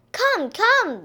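high beckoning call; crying out. A narrator's reading. Parents urging Baby out the door, crying out. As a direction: call eagerly for a child to follow. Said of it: "they cried".

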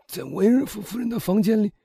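high, strained murmur to himself; dazed murmuring. A narrator's reading. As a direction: repeat it to yourself in dread. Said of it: "他喃喃地说". (惊恐地喃喃自语)

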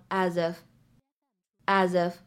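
"as if" slowly linked as "azif"; linked reading. Shown as a demonstration. Teacher models the linking of "as if". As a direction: glide "as" straight into "if". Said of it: "我们可以读成".

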